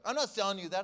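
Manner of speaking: imitating an outraged seller; indignant refusal. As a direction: refuse loudly, as an offended seller. Seller refuses low offer.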